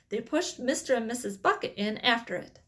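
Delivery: ordinary delivery, nothing marked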